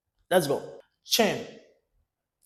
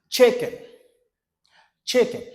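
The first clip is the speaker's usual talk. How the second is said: normal talking voice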